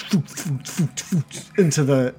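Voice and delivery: quick, airy puffed sound effects; short rapid-fire whooshes; whooshing missile noises. Acted. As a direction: imitate missiles zipping away, one after another. Imitates magic missiles flying.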